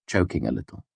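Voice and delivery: usual speaking voice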